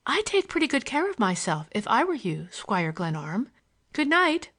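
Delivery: lightly voiced girl, pert and teasing; teasing young woman. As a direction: lift the voice and tease him. Olivia teasingly warns the narrator.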